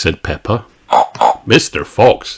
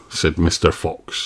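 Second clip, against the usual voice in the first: ordinary delivery, nothing marked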